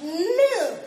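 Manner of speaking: mock petulant no; stubborn refusal. Believer refusing God like a sulky child. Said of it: "And then you're like".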